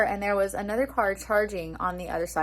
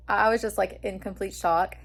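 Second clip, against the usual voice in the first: usual speaking voice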